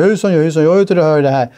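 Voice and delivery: mock stumbling salesman greeting; imitating a salesman. Mocking how people imitate a salesman, stumbling hello. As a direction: play a clumsy pushy seller, tongue tied. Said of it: "när man ska härma en säljare".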